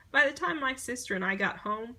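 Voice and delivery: usual speaking voice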